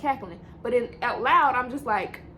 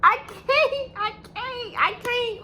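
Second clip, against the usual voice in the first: panicked; 11 semitones higher, 3 dB louder, much slower than usual. Retold or imitated. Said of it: "And I'm like".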